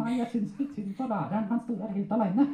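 high, eager ventriloquist puppet voice; puppet storyteller. Acted. Puppet telling handler a school story, high comic voice.

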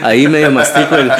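chuckling through the words; laughing at his own English. Real, not acted. Laughing while confessing to hosts his English is shaky.

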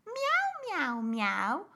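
high, loud cat meowing; loud squeaky kitten meows. Shown as a demonstration. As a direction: meow like a playful cat. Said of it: "Can you make the sound of an animal".

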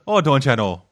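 put-on a light Wisconsin accent, don't ya know; lightly imitated Upper Midwest accent; mock Wisconsin accent. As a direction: toss it off in a hint of Midwestern Wisconsin. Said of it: "more like Wisconsin than Canada".

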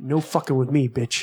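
ordinary talk

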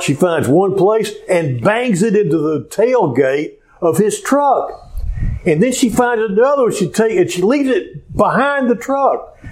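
usual speaking voice

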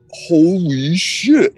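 drawn out very slowly, stunned; slowed-down awe. Retold or imitated. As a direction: let the words crawl like slow motion. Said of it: "everything slows down".

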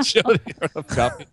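high, laughing echo of the joke. Real, not acted. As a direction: say it while cracking up. Echoes the mom joke laughing.

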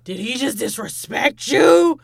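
big, loud, drawn-out sassy hype; hormone monster. Acted. Her period talking to her as a hormone monster, loud and commanding. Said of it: "that's how my period talks to".

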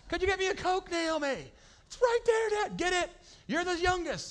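high, put-on back-and-forth voices; father and daughter squabbling over a Coke; mock bickering. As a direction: ham up the family squabble. Dad and daughter bickering acted.

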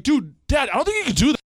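high, loud alarmed warning; shouting a retold warning. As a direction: call out to dad in alarm.

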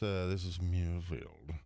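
slow, low English gentleman; English accent. Retold or imitated. English secretary answering a clueless golfer, slow English accent. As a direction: state it slowly in proper English tones. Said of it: "This guy was English".

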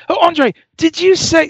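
high-pitched mock English accent; bad English accent. Boss stops musician in the hall, bad English accent. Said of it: "sorry for the bad English accent".

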